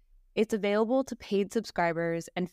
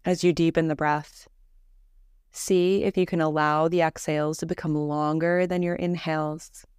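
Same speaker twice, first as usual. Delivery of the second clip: calm, measured meditation voice with long pauses; guided meditation; 3 semitones lower, 5 dB louder than usual. Acted. Teacher leading a listener through Yoga Nidra breathing, guided meditation voice. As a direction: guide them gently into rest, evenly and unhurried.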